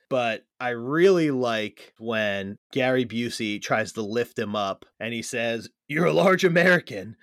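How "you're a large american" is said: gruff quoted line. Retold or imitated. Quoting Busey line.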